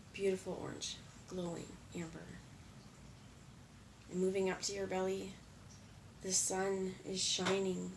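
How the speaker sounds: calm meditation voice with long pauses; guided meditation. Real, not acted. Leading viewers through breath and chakras, calm and measured. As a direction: guide them gently through each breath, unhurried, leaving space between phrases.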